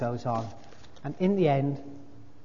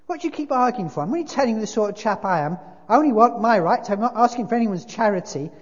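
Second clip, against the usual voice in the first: loud, high-pitched protest; self-righteous big ghost, indignant; 8 semitones higher, 8 dB louder, faster than usual. A narrator's reading. Ghost defending his life to the spirit, loudly indignant. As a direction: insist on your good record, hard and loud.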